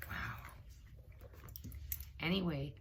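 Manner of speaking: ordinary delivery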